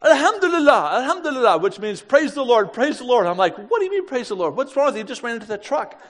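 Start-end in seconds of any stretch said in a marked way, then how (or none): 0.0-1.6 high, loud cry of praise; exultant praise. Retold or imitated. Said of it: "jumps up and goes". 2.0-3.3 high, loud rejoicing; imitated joyful outburst; exultant praise. Translating the jubilant shout. 3.6-6.0 high-pitched retold disbelief; imitated exasperated disbelief; incredulous.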